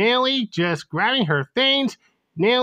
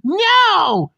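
loud, high, drawn-out scream of refusal; yelling; 11 semitones higher, 6 dB louder than usual. Acted. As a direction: yell it out angrily, high and loud. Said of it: "Nellie just yelling Green".

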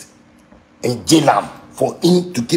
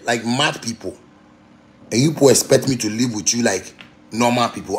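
ordinary delivery

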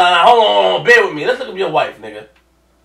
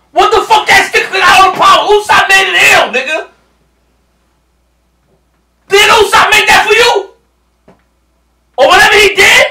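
much higher and louder, almost crying out; excited yelling; 12 semitones higher, 7 dB louder than usual. Real, not acted. Fan reacting to Usopp's stick on screen, hyped yell. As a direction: shout it in hyped disbelief.

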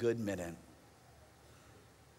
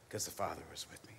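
hushed, softer whisper; 8 semitones lower, 10 dB quieter than usual. Acted. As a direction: breathe it out as a secret comfort. Intimate closing of Jesus' words.

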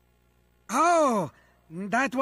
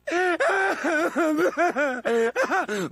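gloating laugh; 3 semitones higher than usual. Acted. Villain gloats.

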